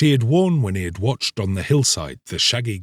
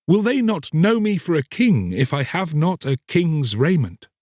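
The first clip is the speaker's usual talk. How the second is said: lightly voiced young king, higher; doubtful young king; 7 semitones higher than usual. A narrator's reading. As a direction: question it gently, puzzled. Young king questions chamberlain.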